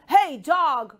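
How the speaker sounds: loud frog hailing someone; shouting frog. Acted. Frog hailing a dog on the road, shouted. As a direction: call out boldly as a big-mouthed frog. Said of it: "shouted the frog".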